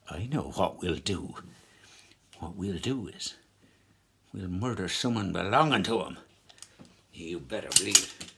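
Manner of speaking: low, soft scheming IRA man; plotting rebel. Acted. Rebel plots revenge on the minister, low and scheming.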